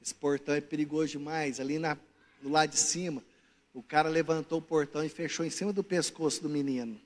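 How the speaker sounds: low, subdued voice of warning; grave neighbour. Acted. Neighbour warning that the gate is dangerous, grave.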